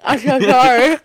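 loud, high garbled apology; imitated full mouth. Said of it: "With my mouth".

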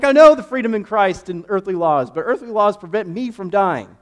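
loud, high frantic yelling; panicked shout. Retold or imitated. Passenger yelling at his swerving friend, loud and high in panic. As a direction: shout at him to stop before you crash. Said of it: "I'm like".

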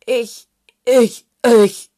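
too worked up to get past one word; speechless sputtering. A narrator's reading. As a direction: explode on each repeated word. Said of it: "nicht mal mehr richtig schimpfen".

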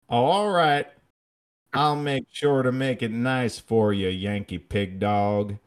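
loud, high-pitched Southern drawl; hammed-up Southern drawl; insane Southern drawl. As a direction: imitate a professor's exaggerated Southern drawl. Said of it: "most insane drawl".

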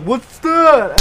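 high, loud startled question; surprised. Retold or imitated. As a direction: blurt it like a shocked kid. Class sees screen, retold reaction.

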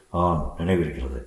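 low, soft, uneasy admission; hushed and nervous; slightly trembling. A narrator's reading. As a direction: answer warily with a slight tremor. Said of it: "சிறிது நடுக்கத்துடனே".

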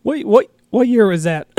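choking a bit mid-question. Real, not acted. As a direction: speak through a choke. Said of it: "Sorry, choking here".

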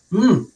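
short, loud, high closed-mouth "mm"; muffled wired-shut mumble. Retold or imitated. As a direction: imitate trying to talk with the jaw wired shut. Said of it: "all you hear is".

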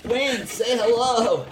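high slow coaxing to a puppy; high, slow puppy talk; dog voice. Real, not acted. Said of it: "We all have our dog voices".

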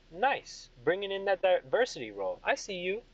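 high-pitched royal teasing praise; approving king. Acted. King praising General Vega, approving. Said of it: "he turns towards General Vega and he goes".

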